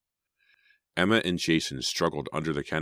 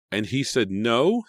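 usual speaking voice

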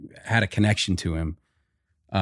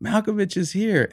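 higher, awed crew chatter; mock crew excitement; 11 semitones higher, slower than usual. Crew marveling at Malkovich, high excited mock awe. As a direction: gush like a starstruck crew. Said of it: "the crew's like".

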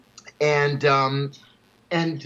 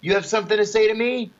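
retold shout; 6 semitones higher than usual. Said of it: "I shouted".

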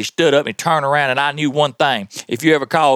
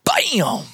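imitated gunshot bam; 10 semitones higher than usual. Grandma fires the pistol.

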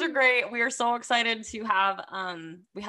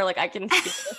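normal talking voice

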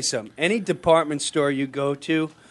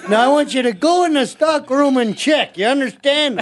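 mock tough threat; 9 semitones higher, 5 dB louder than usual. Teenage prankster threatening the toy clerk, loud mock bully. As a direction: lean on him like a playground bully.

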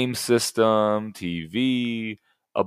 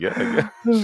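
surprised; 13 semitones higher than usual. Retold or imitated. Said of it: "She's surprised".

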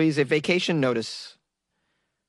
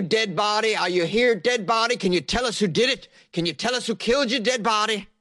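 seance caller; 8 semitones higher than usual. Acted. Sheriff running a seance for the dead body, high intoned summons. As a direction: call out to the spirits, pitch raised.

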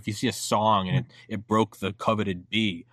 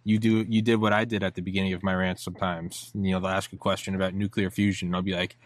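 ordinary delivery

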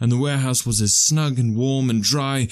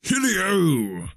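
high, falling holler without much volume; jovial cry; 8 semitones higher than usual. Acted. Fezziwig hails his apprentices, high jovial cry. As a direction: sing out a merry hail. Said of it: "cried old Fezziwig".

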